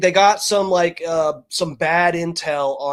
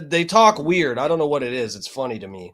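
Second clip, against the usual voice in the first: ordinary talk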